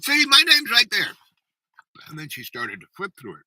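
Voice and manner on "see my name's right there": high, loud, pointing it out in disbelief; insistent exclaim. Retold or imitated. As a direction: prove it, voice up high. Woman pointing to her name.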